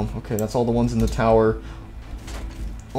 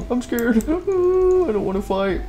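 mock fright; 9 semitones higher, slower than usual.

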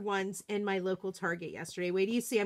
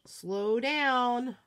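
ordinary talk, nothing marked

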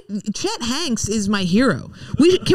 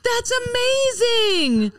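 high, drawn-out gush; gushing amazement; 11 semitones higher, much slower than usual. Real, not acted. Mother marvelling at what she just noticed, high gushing amazement.

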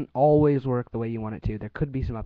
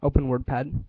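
news anchor voice. Real, not acted. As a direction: enunciate every word like a newsreader. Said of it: "use your news anchor voice".

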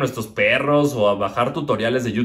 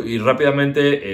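usual speaking voice

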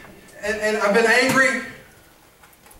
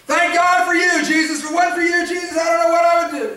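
higher, shouting thanks, speeding up at the end; excited shouted praise; 7 semitones higher than usual. Acted. Acted crowd praising Jesus.

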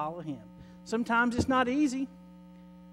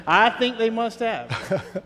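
laughing; 2 semitones higher, 8 dB louder, slower than usual. Real, not acted. Laughs at joke.

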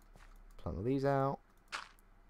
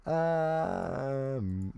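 voice crack; 5 semitones higher, 3 dB louder than usual. Real, not acted. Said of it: "ooh, voice crack".

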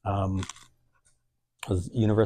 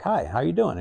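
high, eager hello; bright high greeting; 9 semitones higher, 5 dB louder than usual. Retold or imitated. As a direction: greet him brightly, pitched way up. Greeting a shy teen.

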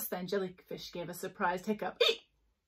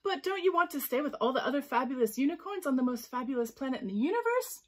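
loud, higher storybook question climbing high at the end; puzzled question; 5 semitones higher, 7 dB louder than usual. A narrator's reading. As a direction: ask incredulously, pitch soaring by the finish. Mermaid questions Unicorn in surprise.

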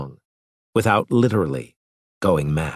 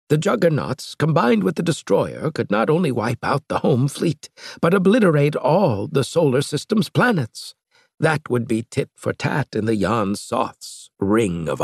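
lightly raised, grave scientist voice; slightly higher, earnest Ludendorff; Ludendorff's voice; 6 semitones higher than usual. A narrator's reading. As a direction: voice the old professor's warning a touch higher. Ludendorff voiced in read story.

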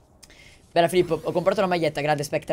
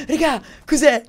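startled cry; 10 semitones higher, 7 dB louder than usual. Real, not acted. Sudden surprise at enemies.

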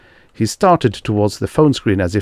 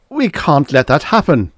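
high, louder, anxious objection; alarmed protest; 8 semitones higher, 5 dB louder than usual. A narrator's reading. Manford refusing to accept the show's ruin, alarmed. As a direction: object in rising panic.

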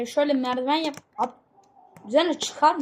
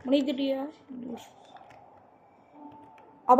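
usual speaking voice

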